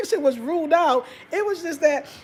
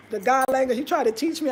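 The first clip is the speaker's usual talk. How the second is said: ordinary talk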